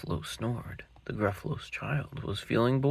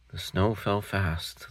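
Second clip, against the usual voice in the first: plain reading voice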